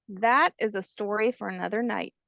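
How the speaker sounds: ordinary delivery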